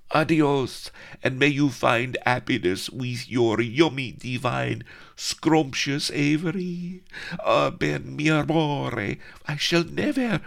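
high, swooning tragic farewell; melodramatic heroine. Acted. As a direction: ham up the goodbye, pitched high.

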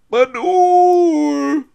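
long, high street vendor's cry; quavering, melancholy shout. A narrator's reading. Old peasant calls his wares, quavering melancholy shout. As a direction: wail it out over the street, drawn out. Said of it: "the old peasant cried".

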